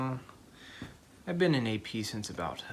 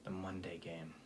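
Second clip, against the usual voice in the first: whispering; 6 semitones lower, 11 dB quieter than usual. Acted. Student whispers dreaded game.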